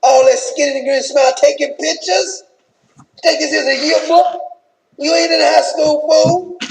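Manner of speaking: wife berating husband back in the room, mock furious; angry Michelle. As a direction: chew him out like a furious wife. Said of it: "When they get back to the room, like".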